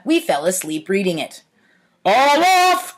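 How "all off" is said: higher, louder call of all off; conductor's cry. A narrator's reading. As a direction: call it down the train car. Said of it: "cried the conductor".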